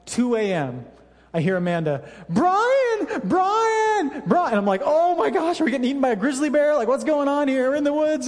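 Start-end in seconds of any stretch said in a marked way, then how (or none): 2.3-4.5 urgent calling out. Retold or imitated. Wife waking husband at 2am, high urgent calls. 4.8-8.3 mock panic. Waking startled in tent.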